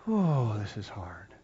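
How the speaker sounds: unvoiced sighing whisper; whispered lament. Preacher voicing a weary believer's sigh, whispered.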